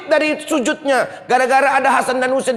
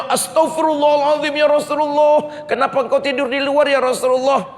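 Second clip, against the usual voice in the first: higher, startled cry of concern; shocked wife; 5 semitones higher than usual. Acted. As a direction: gasp it out in worried surprise. Aisyah finds him asleep outside.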